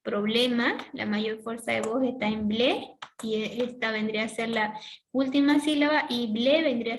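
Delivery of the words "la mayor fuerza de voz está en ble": usual speaking voice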